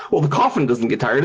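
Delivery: ordinary delivery, nothing marked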